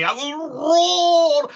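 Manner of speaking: high, slow, loud roar. Acted. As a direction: stretch the roar out big.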